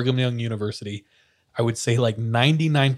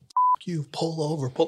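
higher-pitched mock yell, held down in volume; muted, raised-pitch retold shout; retold screaming; 5 semitones higher, 5 dB quieter than usual. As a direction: imitate yelling from another car without full volume. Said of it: "They're screaming at my dad and his friends".

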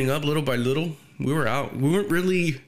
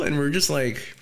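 ordinary delivery

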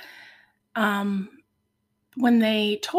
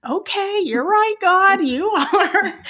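high, bright laughing agreement, louder; laughing relief; 9 semitones higher, 7 dB louder, slower than usual. Retold or imitated. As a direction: laugh through the words as you give in. Laughing reply to God's answer.

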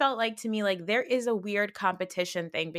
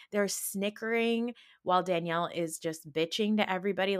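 ordinary talk, nothing marked

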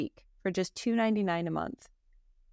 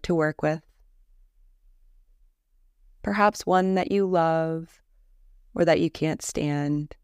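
calm, measured Yoga Nidra guidance with long pauses; guided meditation; 3 semitones lower, 5 dB louder than usual. Acted. Teacher leading a listener through a Yoga Nidra body scan, guided meditation voice. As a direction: lead them gently into rest, leaving space between phrases.

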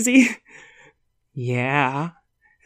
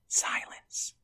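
whisper; 14 dB quieter than usual. A narrator's reading. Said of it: "narrator whispers".

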